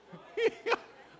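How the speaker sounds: high breathless burst of laughter; real laugh. Real, not acted. Pastor breaks into high breathless laughter at the meme. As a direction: crack up at the absurd picture.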